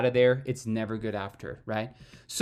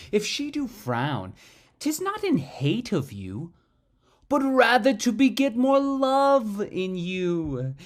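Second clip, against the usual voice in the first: big, swelling Shakespeare delivery, building to loud peaks; dramatic monologue recital; 3 semitones higher, 4 dB louder, slower than usual. Shown as a demonstration. Acting teacher performs a monologue for students, theatrical and swelling. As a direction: perform the verse with full theatrical force. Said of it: "I'm just gonna say a Shakespeare monologue".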